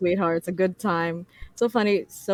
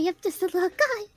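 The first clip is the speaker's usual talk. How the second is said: high squeaky little creature; tiny little guy voice; 12 semitones higher, 3 dB quieter than usual. Pretend. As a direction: say it like a tiny critter.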